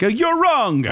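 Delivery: Italian accent, high and exaggerated. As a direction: put on a mock Italian accent. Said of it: "some Italian listener out there being like".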